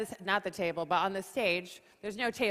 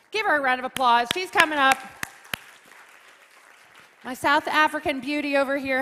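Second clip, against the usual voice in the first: louder, calling out to the room; rally applause for her; loud hype call; 3 semitones higher, 7 dB louder than usual. Real, not acted. Host hyping the moderator's entrance, loud.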